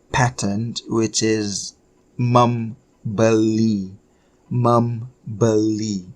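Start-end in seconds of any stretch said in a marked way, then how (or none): 2.2-6.1 "membeli" slowly, pausing after "mem"; slow sounding-out. Shown as a demonstration. Teacher modelling the formal verb for learners, slowly sounded out. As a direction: draw out "membeli" as "mem... be-lii".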